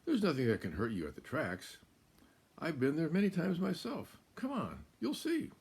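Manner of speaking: high, loud, coaxing fox voice; sly little fox. Acted. Fox luring Cow to explore the countryside, high and coaxing. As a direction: tempt her like a playful little fox. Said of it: "and continued".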